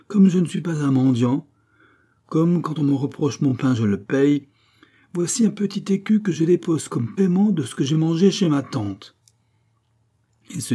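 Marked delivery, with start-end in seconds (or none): 0.1-9.2 raised, proud public declaration; voix de stentor. A narrator's reading. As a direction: announce it proudly for all to hear. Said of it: "d'une voix de stentor". (déclaration publique fière, voix haute)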